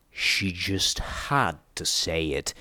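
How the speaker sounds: low, slow, resigned mutter. A narrator's reading. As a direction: grumble it under your breath, dragging the words. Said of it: "P twenty-one muttered".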